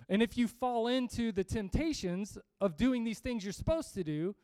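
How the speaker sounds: ordinary talk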